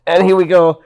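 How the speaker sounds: pumped storytelling burst; excited. Real, not acted. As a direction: relive the moment with a rush. Reliving the big spot.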